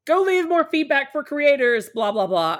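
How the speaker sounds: lightly hammed-up scolding; mock preachy nagging. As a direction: parody a pushy reminder to leave feedback. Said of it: "a whole thing where it's like".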